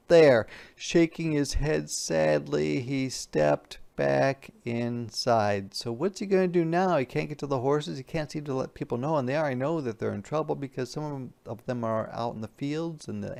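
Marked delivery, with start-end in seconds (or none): none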